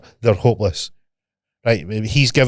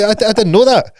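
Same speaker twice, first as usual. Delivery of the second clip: high, loud flustered protest; retold astonishment; 11 semitones higher, 5 dB louder than usual. Scotsman admitting he never knew about Irish Gaelic, amazed.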